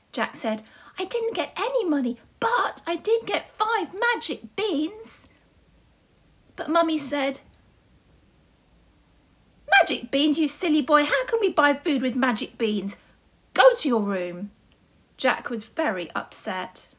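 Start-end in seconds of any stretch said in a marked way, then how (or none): 1.0-5.3 put-on high, childlike voice; little Jack. Jack answers his mum. 9.8-14.5 a touch louder, cross telling-off; scolding mum. Acted. Mummy scolds Jack.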